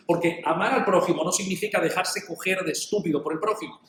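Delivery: normal talking voice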